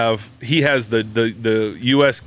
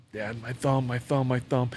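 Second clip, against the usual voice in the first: retold hurt whimper; 9 dB quieter than usual. Retold hurt son near tears.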